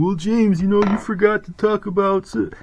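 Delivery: high, whiny mock commenter voice; nitpicking commenter. Imagined viewer in the comments nitpicking, mock whiny voice. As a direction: mimic a know-it-all viewer correcting him. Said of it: "Somebody saying".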